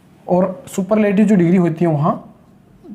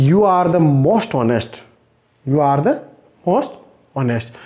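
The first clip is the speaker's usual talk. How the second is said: very slow, word by word; teacher dictating a sentence for students to write down; slow dictation; 4 semitones lower, much slower than usual. Shown as a demonstration. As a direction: read it out slowly for students to write. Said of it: "मुझे लिखना पड़ेगा".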